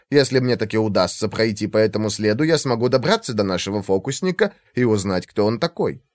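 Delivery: usual speaking voice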